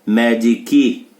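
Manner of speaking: "Medic" said as me-di-ki, the final C released with an extra i; C linked into E. Shown as a demonstration. Teacher modeling "Medic" for students, final C released into an i. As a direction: show the linking: "Medic" as me-di-ki. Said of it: "o C tem som de K e junta no E".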